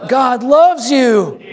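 high, slow emphatic preacher's cry; like you mean it. Shown as a demonstration. Pastor showing the congregation how to mean it, fervent proclamation. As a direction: proclaim it with full conviction, drawn out and high. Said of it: "Now say it like you mean it".

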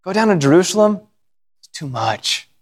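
ordinary talk

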